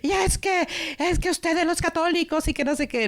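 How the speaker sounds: high, mock-furious teen outburst; imitating an angry teen. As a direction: toss it off like a fed-up teenager, half-acted. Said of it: "la adolescente se enfurece y dice".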